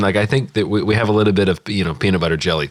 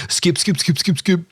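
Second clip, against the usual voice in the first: impatient viewers; 10 semitones higher than usual. Retold or imitated. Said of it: "People are like".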